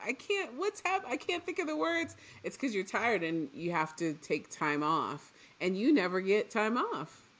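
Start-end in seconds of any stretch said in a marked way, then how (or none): 0.0-2.1 higher-pitched baffled self-questioning; frustrated self-quote. Retold or imitated. Co-host recalling studying late and tired, frustrated. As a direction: replay your own frustration, voice pitched up.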